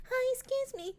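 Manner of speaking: put-on a small lost girl greeting strangers; timid little girl. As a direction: ask shyly like a little girl. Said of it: "all she was doing was like".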